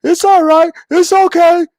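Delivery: high, loud mock cheering for beaten boys; encouraging sideline parent. Parent calls from the sideline to the losing team, high mock cheer. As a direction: chirp encouragement like a fake-cheerful sports mom. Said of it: "one of the most encouraging parents on the sideline".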